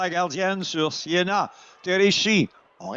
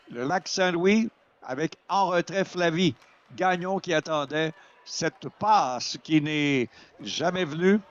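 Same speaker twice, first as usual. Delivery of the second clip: normal talking voice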